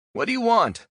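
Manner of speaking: very high, loud panicked cry; terrified shout. Acted. Prisoner hanging in silo shouts up to captor, terrified. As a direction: shout up in fear.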